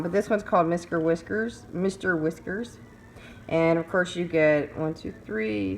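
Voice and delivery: normal talking voice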